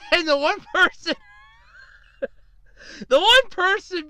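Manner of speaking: laughter bursting into the story; laughing. Real, not acted. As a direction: struggle to get it out through laughter. Cracks up telling the story.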